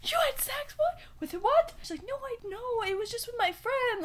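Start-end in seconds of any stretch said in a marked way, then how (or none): 0.0-1.7 high-pitched imitated shock; shocked disbelief. Girl's shocked question, acted out. 2.1-4.0 high-pitched imitated protest; flustered denial. Girl's flustered denial, acted out.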